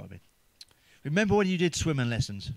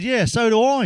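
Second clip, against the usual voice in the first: mate's quip; 7 semitones higher, 8 dB louder than usual. Retold or imitated. Mate's punchline quote.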